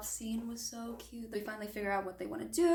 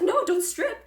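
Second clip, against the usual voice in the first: high, loud yelp of protest; alarmed protest; 12 semitones higher, 7 dB louder than usual. Acted. Olive stopping Ethan pulling off his clothes, alarmed protest. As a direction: cry out to stop them, pitched way up.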